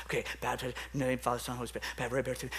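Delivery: rapid, low, under-the-breath run-through; hurried mumbling to oneself; muttered rehearsal. Retold or imitated. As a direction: rattle it off softly as if memorising it. Nervously rehearsing the words in his head.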